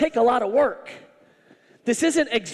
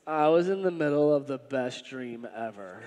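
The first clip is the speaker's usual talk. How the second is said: groggy annoyed Jesus; 10 semitones lower, 6 dB quieter than usual. Retold or imitated. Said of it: "Like, he's kind of annoyed".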